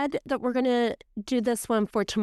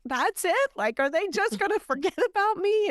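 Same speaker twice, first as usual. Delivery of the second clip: mock distraught disbelief; 10 semitones higher than usual. Voicing the anxious mom.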